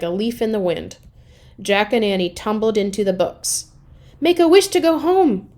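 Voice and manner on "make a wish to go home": high, loud urgent plea; urgent cry. Acted. Annie urges Jack to wish them home. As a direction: call out in a hurry. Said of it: "cried Annie".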